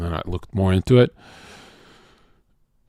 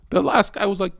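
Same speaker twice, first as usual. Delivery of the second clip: ordinary talk, nothing marked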